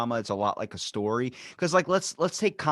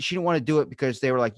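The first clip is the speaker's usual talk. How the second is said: ordinary talk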